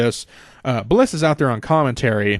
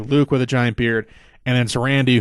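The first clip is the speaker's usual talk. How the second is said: ordinary talk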